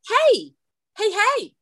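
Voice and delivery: high-pitched holler at a class; retold teacher's holler. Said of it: "I had to holler".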